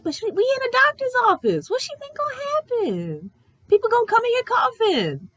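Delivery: high, incredulous rant; can't believe this nonsense; exasperated. Retold or imitated. Venting to mom about the therapist, exasperated and incredulous.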